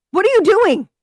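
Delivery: high, loud panic; panicked. A narrator's reading. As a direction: cry out in alarm.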